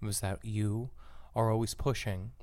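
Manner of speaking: low, soft, restrained podcast voice; hushed volume. Shown as a demonstration. Host demonstrates the speech pathologist's way, hushed and low. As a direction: talk low with the volume right down. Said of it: "the volume come down".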